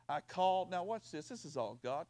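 normal talking voice

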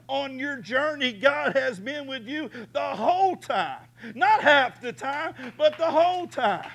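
high, loud, building preaching; full-force preaching. Real, not acted. Preacher promising the congregation God stays with them, rising to a ringing climax.

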